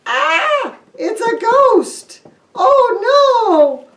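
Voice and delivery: high, loud startled fear; frightened disciples. Acted. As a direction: shriek at a ghost in the room. Disciples see Jesus appear.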